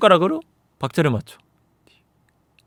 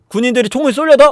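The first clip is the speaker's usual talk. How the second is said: usual speaking voice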